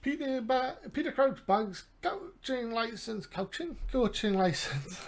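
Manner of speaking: high, loud, silly put-on voice; weird voice. Gamer reads out Peter Crouch's coaching license news, weird voice. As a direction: stretch the words out slowly in a weird high voice. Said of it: "say it in a weird voice".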